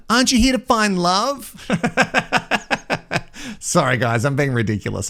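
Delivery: ordinary talk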